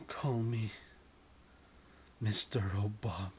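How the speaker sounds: hushed sultry request; seductive whisper. Acted.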